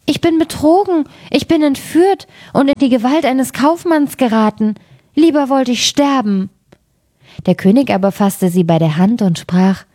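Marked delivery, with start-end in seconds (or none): 0.1-6.5 much higher, alarmed and despairing; frightened lament. A narrator's reading. Princess realising she was abducted, frightened and high. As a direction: cry out that you've been tricked. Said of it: "rief sie erschrocken".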